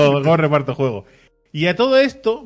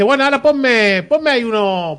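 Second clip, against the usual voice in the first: higher, louder dithering customer; mock customer order; 8 semitones higher, 4 dB louder than usual. Customer ordering from baker, higher mock voice. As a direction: order like you can't decide.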